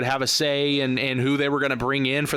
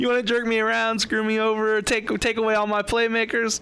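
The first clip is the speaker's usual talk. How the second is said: higher, sarcastic don't-care voice; mock shrugging it off; 7 semitones higher than usual. Cohost pretending a big payday fixes everything, higher mock indifference. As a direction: laugh off the bad treatment. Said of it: "I wouldn't care".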